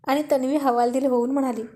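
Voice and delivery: ordinary delivery, nothing marked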